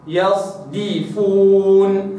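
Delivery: يصدفون slow, light dal; Quranic recitation. Shown as a demonstration. Teacher models يصدفون slowly. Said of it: "هكذا".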